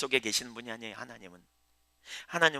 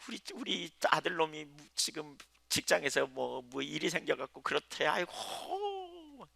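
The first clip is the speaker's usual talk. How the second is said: imitating a fretting old woman's higher, wailing prayer; wailing church lady; 5 semitones higher, slower than usual. Preacher mimicking a worried church member, wailing and drawn out. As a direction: ham up her drawn-out "아이고". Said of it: "그러는 거예요".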